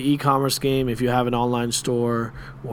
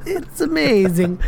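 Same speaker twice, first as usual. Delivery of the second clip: high playful squeal; 11 semitones higher, slower than usual. Pretend. Playful repeat of outro line.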